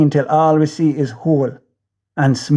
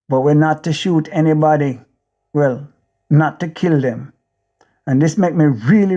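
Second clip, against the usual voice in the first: plain reading voice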